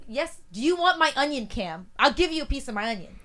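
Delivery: exasperated offer. Retold or imitated. Co-host retells offering housemate her onion, exasperated. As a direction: answer him with worn-out patience, pushed up.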